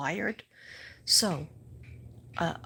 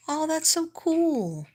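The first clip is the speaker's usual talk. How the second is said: high and loud, stretched out slowly; a big slow "oh, that's so cool"; delighted gush; 10 semitones higher, 5 dB louder, slower than usual. Real, not acted. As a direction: gush over the good news. Delighted reaction to viewer's news.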